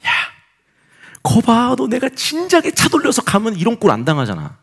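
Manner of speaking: high, insistent told-you-so; scolding inner voice. Retold or imitated. Inner voice blaming the pastor for not turning back, scolding. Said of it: "내 안에 소리가 들려오는".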